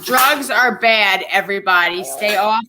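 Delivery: slow, drawn-out mock warning; mock public warning. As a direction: stretch it out like a playful announcement.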